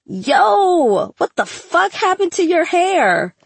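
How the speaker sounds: high, loud shout of disbelief; screamed. A narrator's reading. Classmate spots the burnt orange hair, screaming. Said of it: "Trevor screamed".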